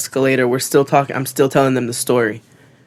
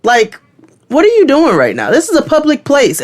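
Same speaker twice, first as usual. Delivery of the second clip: high, loud-ish outraged friend; indignant, pitched-up mock scolding; retold scolding; 12 semitones higher, 4 dB louder than usual. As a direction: re-enact telling off a stranger in public. Acted friend scolding a stranger.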